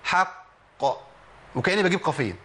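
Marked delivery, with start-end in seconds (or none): 0.0-0.5 الحق with the final qaf held long; extra-long stopped qaf; strongest qalqala. Shown as a demonstration. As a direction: give الحق double-qaf time. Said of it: "بدي زمن زيادة شوية".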